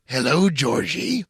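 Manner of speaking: slow, creepy drawn-out greeting; Pennywise. Pretend. Pennywise greeting Georgie from the drain, slow and creepy. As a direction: lure the kid in, slowly. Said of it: "I'm Pennywise".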